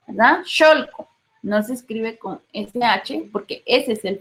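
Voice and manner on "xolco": Xolco said as SHOL-co; higher-pitched Xolco with X sounded as SH. Shown as a demonstration. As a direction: begin Xolco with a hushing SH. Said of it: "el fonema suena como S H".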